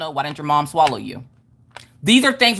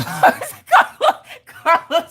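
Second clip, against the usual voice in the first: laughing; 13 semitones higher, 4 dB louder, much slower than usual. Real, not acted. Laughing while reading comment.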